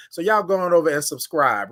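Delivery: ordinary talk, nothing marked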